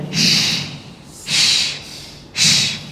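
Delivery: "shh" with lips drawn wide; sh pulled sideways. Shown as a demonstration. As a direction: spread the lips for a thin "shh". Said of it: "tarik ke samping". ("shh" ditarik ke samping)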